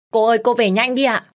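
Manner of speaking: loud, high urgent yell; shouting. A narrator's reading. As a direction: call her home in a panic. Maid urgently calling her home.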